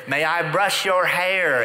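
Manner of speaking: retold yell. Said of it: "she has to yell".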